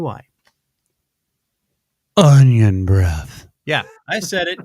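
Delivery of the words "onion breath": low, loud, drawn-out proclamation; mock-ominous punchline. Host revealing why the salsa skips onions, mock-ominous. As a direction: boom it out deep and slow.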